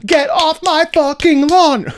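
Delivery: high, loud, slow yell; angry shout. Pretend. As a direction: bellow it like a furious old man. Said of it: "so much intensity in that".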